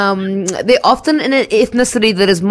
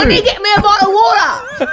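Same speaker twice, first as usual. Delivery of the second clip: high, hammed-up English accent; put-on English accent; 9 semitones higher than usual. Shown as a demonstration. Co-host mimicking her own shopping voice, comic English accent. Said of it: "when I use that voice".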